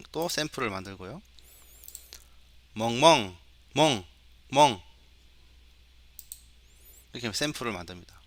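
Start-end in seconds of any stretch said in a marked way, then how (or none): 2.7-4.8 spoken, evenly spaced dog woofs, a bit loud; dog bark imitation. Shown as a demonstration. Teacher recording dog samples for the computer, imitated barking. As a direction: imitate a dog with short, separate barks. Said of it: "이런 소리는 강아지야".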